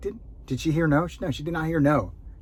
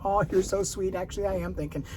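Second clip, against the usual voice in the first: charmed homeowner; 6 semitones higher, 4 dB quieter than usual. Pretend.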